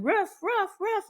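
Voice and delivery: high, yappy imitation of a barking dog; imitated dog bark. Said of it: "she is literally barking".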